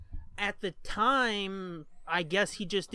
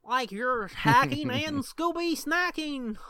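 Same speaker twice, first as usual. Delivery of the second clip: higher, slow cartoon sidekick voice; silly cartoon voice; 6 semitones higher, 3 dB louder, slower than usual. Shown as a demonstration. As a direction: stretch it out like a goofy toon. Repeats line in character voice.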